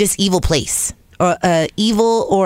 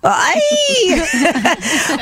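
delighted shriek; 11 semitones higher than usual. Real, not acted. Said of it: "Why do men love sexy raspy voices".

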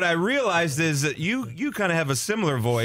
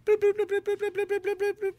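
squeaky bloops. Pretend. Counting-out game.

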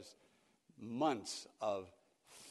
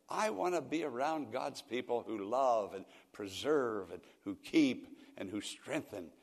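ordinary talk

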